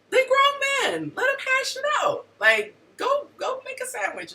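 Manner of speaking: squeaky mock voice, very high. Woman mimicking advice to stay out of it, squeaky high. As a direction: toss it off in a silly squeal. Mocking voice about letting men fight.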